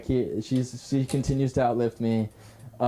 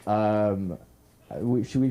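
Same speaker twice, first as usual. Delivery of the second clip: ordinary talk, nothing marked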